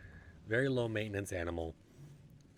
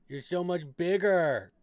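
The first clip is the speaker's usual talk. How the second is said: pet talk; 9 semitones higher, 8 dB louder than usual. Real, not acted. Owner gushing at the big rat in slow high baby talk.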